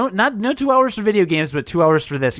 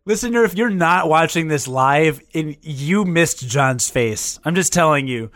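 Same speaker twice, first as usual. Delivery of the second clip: usual speaking voice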